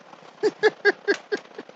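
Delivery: a sudden squeaky laugh; high laugh. Real, not acted. Laughs at own old man voice.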